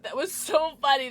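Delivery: ordinary delivery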